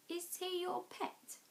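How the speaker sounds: lightly voiced boy asking; curious boy. A narrator's reading.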